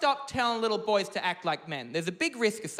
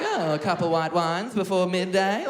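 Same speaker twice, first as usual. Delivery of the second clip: slow, louder tipsy-aunt voice; fun aunt; 6 dB louder than usual. Acted. Fun aunt drinking before midday, loud and drawn out. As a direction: draw it out like a boozy fun aunt. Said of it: "like a fun aunt".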